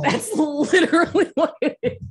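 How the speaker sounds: high, laughing disbelief. Real, not acted. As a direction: laugh through the words. Laughing repeat of the quote.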